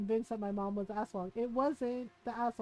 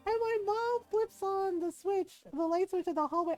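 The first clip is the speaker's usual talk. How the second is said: ordinary talk, nothing marked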